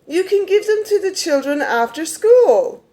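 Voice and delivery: a mocking imitation of the assistant principal. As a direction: mimic her condescending suggestion. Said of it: "what she said to me next was".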